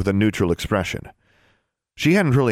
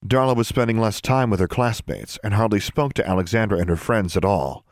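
usual speaking voice

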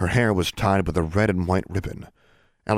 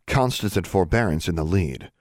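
ordinary delivery

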